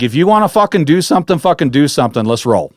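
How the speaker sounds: mock yell, daring it to fight; retold yell. Camper daring the creature to come at him, retold yell. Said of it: "I yelled at it".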